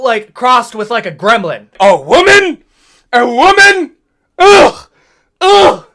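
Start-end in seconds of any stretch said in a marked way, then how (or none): 1.8-4.0 mock disgust. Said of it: "repeated mock disgust". 4.4-5.9 loud high disgusted groan; disgusted ugh. Acted. Disgusted outburst noises.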